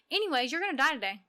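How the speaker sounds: high, fast and offhand; careless caller. Retold or imitated. Imitating the rude caller breezily announcing death, high and fast. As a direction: toss off the death news like a chore. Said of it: "She's like".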